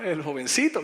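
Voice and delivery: ordinary talk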